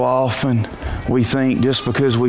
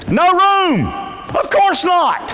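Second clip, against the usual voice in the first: exclaimed shout; slower than usual. Acted. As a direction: hit it like an indignant punchline. Preacher exclaims inn was full.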